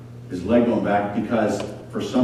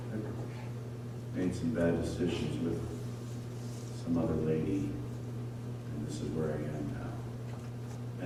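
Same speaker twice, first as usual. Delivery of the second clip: slow, much softer, broken confession; ashamed confession; 6 semitones lower, 17 dB quieter, slower than usual. Acted. Father confessing to his crying kids, slow and hushed. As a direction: murmur it out in shame, slowly.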